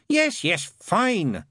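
high, loud, exasperated agreement; sighed impatiently. A narrator's reading. Crowley interrupting Aziraphale's hypnosis, exasperated. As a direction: cut in with weary impatience. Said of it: "sighed Crowley".

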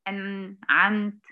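"أنعمت" broken after a held ن; wrongful gap. Shown as a demonstration. Teacher showing what not to say, "أنعمت" split after ن. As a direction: leave a gap before the ع of "أنعمت". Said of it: "ما أعملش الفاصل ده".

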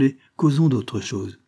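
plain reading voice

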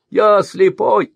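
high, loud, slow whine; evasive merchant. A narrator's reading. Merchant dodges the mercenary's question, whining. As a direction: plead blindness.

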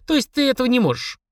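put-on high girlish questioning; needling little girl; child's voice. A narrator's reading. Girl challenging the demon, high child voice. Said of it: "уточнила Полина".

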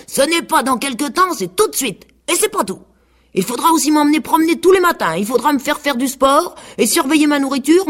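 high, whiny pig voice; vain pig. A narrator's reading. Pig refusing his mash and demanding the seed, high and vain. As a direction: dream out loud of being admired.

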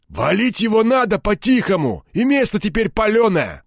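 high-pitched, urgent crook; agitated thug. A narrator's reading. Third crook urging gang to kill Egor, agitated.

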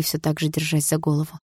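plain reading voice

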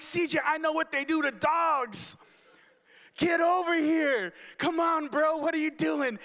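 high, desperate crying call; panicked pleading. Retold or imitated. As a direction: beg the dog in rising fear. Begging dog to come inside.

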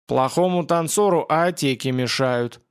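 ordinary delivery

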